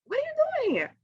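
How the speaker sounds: very high startled question; shocked to see mom there; surprised. Retold or imitated. Waking up to find her mother at her bedside, very high startled question. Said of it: "And I'm like".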